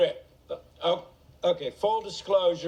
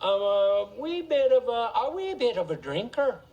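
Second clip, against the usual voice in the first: light Irish lilt on a wee bit of a drinker; Irish accent; 2 semitones higher than usual. Acted. Telling the crowd about his drinking, in a light Irish accent. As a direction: slip into a mild Irish accent for a beat. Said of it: "I don't know why I went Irish there".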